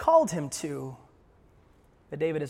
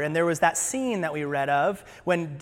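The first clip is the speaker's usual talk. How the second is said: normal talking voice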